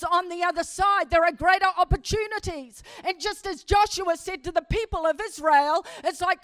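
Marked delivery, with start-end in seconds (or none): none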